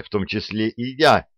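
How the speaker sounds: plain reading voice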